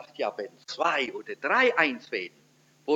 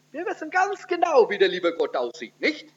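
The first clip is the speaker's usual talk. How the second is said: mock certainty; 8 semitones higher than usual. Ironic claim about knowing God.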